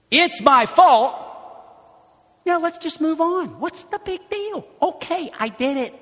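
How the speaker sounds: high, loud brushing-off; dismissive. Acted. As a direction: shrug it off impatiently. Voicing dismissive confession.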